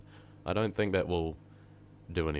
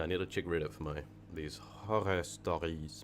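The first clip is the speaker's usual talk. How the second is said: ordinary talk, nothing marked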